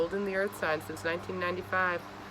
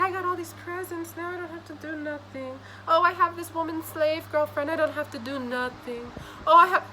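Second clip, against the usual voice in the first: mocking lazy voice; 9 semitones higher than usual. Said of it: "You're like".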